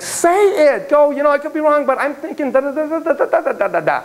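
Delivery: high-pitched pleading, running off into da-da filler; mock exasperated urging. Supervisor imagining prodding silent students to speak, mock exasperated.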